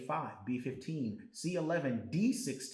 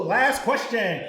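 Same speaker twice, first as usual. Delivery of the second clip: ordinary talk, nothing marked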